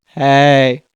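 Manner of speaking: low, loud, drawn-out greeting; weird voice. Retold or imitated. Mom calling the girlfriend unannounced, weird low voice. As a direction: imitate mom's odd deep phone voice. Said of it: "There's this weird voice saying".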